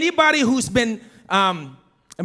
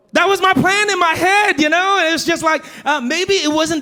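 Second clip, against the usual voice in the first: sheepish shout; 8 semitones higher, 5 dB louder than usual. Real, not acted. Sheepish exclamation to congregation.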